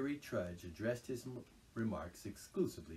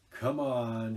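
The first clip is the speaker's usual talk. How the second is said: grim; 3 semitones higher, 11 dB louder than usual. A narrator's reading. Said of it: "he said grimly to the mole".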